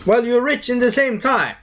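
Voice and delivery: high-pitched mock indignation; incredulous questioning. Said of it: "And I'm like".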